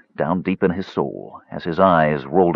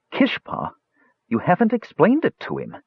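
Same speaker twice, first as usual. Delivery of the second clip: interjecting friend; 12 semitones higher than usual. Acted. Klotnik interjects to the mage.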